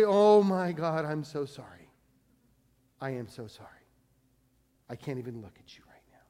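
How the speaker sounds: hushed, trembling confession falling to a whisper; broken whisper of apology. Retold or imitated. Sinner on his face before God, whispered. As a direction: whisper it, ashamed and overwhelmed.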